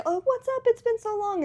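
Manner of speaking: very high-pitched, breezy hello; put-on high social voice; mock friendly greeting. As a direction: act out an overly chirpy catch-up. Acting out a planned casual greeting.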